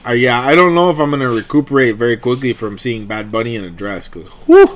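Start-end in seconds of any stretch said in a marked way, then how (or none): 4.5-4.6 high squealing whoo; excited whooping. Real, not acted. Gushing over [name] in dress.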